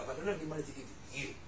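rushed quiet cover line after the laughter; quick mumbled excuse. Retold or imitated. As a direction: mumble it quickly so it barely registers. Person dodging a loan request.

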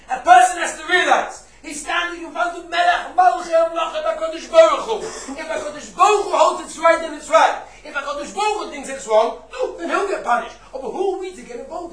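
loud, high-pitched sermon voice; impassioned preaching. Real, not acted. Rabbi telling listeners they stand before God, impassioned loud preaching. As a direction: drive the moral home at full force. Preaches the moral at full force.

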